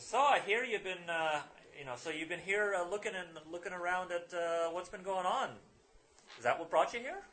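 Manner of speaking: put-on high, loud Irish-accented questioning. Newspaperman asks the players why they came, Irish accent. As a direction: probe them in a chummy Irish brogue. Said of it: "I'm gonna drop the accent".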